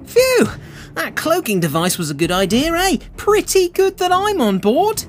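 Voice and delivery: high, gleeful and pleased with himself; genuine glee. Acted. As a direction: crow about it with real delight. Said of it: "he said with genuine glee".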